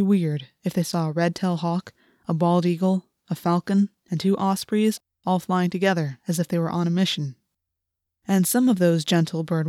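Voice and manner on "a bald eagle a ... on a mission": ordinary delivery, nothing marked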